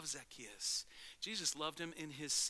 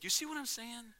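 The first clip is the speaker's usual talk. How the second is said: ordinary delivery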